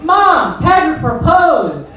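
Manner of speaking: high, loud, slowed joyful news; thrilled to tell her mother; bride-to-be calls her mom, mock squealing; excited announcement. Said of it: "she's like".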